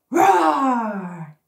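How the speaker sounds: loud drawn-out roar. Shown as a demonstration. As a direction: let the roar out big. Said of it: "you say roar".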